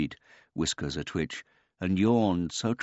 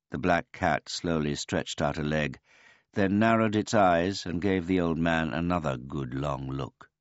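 ordinary delivery, nothing marked

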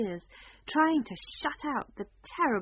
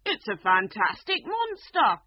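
unicorn crying out, louder and higher; excited cry; 5 semitones higher, 7 dB louder than usual. A narrator's reading. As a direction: announce the monster with glee. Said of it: "the unicorn cried".

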